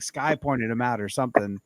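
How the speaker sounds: usual speaking voice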